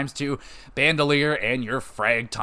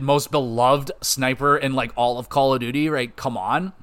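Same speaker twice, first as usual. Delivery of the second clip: normal talking voice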